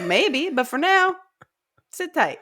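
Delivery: high, playful placating voice; breezily hammed-up comforting, pitched well up; mock reassurance. Conqueror placating his brother, retold.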